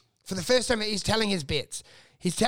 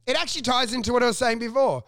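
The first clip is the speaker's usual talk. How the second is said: ordinary delivery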